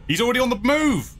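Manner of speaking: high panicked exclamation; alarmed cry. Real, not acted. Animatronic suddenly moving.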